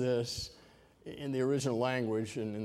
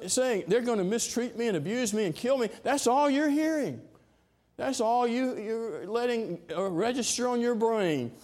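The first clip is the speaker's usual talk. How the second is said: stern rebuke; 9 semitones higher, 5 dB louder than usual. Acted. Jesus rebukes Peter.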